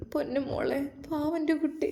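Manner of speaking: high, soft, tearful lament; a light touch of sobbing over the sick child; sobbing softly. Acted. Grandmother softly sobbing over her sick granddaughter. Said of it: "ഉമ്മ വിതുമ്പി കരഞ്ഞു".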